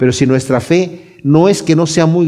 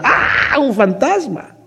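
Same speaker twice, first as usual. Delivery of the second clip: high-pitched mock scream at a ghost; imitated panicked yelp, pitched up; mock frightened cry; 8 semitones higher, much slower than usual. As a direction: act out a startled cry without real volume. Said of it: "Empiezan a gritar".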